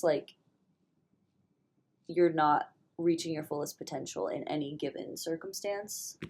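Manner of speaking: ordinary talk, nothing marked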